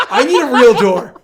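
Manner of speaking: loud laughing complaint; relieved laughter. Real, not acted. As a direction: laugh it out, still jumpy. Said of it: "that scared the shit out of me".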